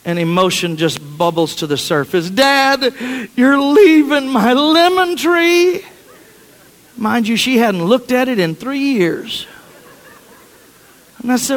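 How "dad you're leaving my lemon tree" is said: put-on high, slow, tearful little girl's plea; tearful daughter. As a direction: plead like a child about to cry. Daughter crying over lemon tree.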